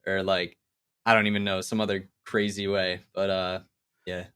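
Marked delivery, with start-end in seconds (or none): none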